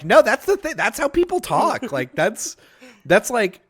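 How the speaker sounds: a mom correcting her kid, half-mocking; mom insisting. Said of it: "my mom was like".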